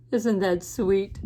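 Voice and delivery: plain reading voice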